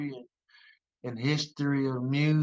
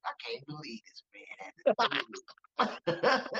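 slow, high, strained complaint; 6 semitones higher, slower than usual. Retold or imitated. Honoree griping to his seatmate, slow disbelieving complaint. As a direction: imitate a disbelieving grumble into someone's ear. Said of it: "the whole time he's in my ear".

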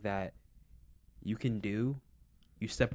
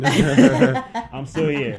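high, loud laughing repeat; 11 semitones higher, 10 dB louder, much slower than usual. Real, not acted. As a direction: say it through a big drawn-out laugh. Laughing at own stalled plan.

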